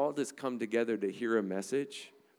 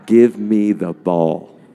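slow, very loud mock yell with a laugh; imitating a yell; 18 dB louder, much slower than usual. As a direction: shout it like a fan demanding the ball. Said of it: "he's like yelling at the ref".

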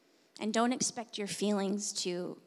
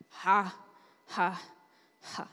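dry laugh; 2 semitones lower, slower than usual. Retold or imitated. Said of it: "I began to laugh dryly".

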